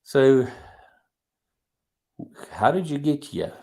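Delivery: normal talking voice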